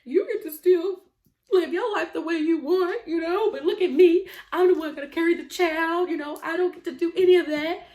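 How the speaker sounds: high, loud mock-jealous grievance; jealous complaint. Pregnant woman to partner who still goes out, jealous griping. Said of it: "I was a little bit jealous".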